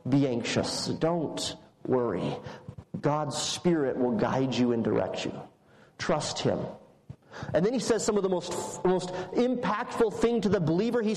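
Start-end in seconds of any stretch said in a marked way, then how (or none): 0.1-6.6 low, unhurried reassurance; reassuring. Acted. Jesus calming disciples before their trials, low and reassuring. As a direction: settle their worry in a lower voice.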